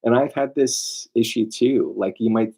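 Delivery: ordinary delivery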